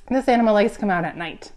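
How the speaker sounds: ordinary delivery, nothing marked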